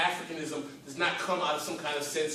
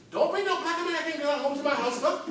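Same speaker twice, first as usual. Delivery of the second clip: higher, stern immigrant mother voice; African mother; 8 semitones higher than usual. Retold or imitated. Speaker voicing an immigrant mother forbidding a match, stern. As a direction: scold like a strict mom. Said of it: "some of our mothers said".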